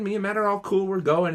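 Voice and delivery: ordinary talk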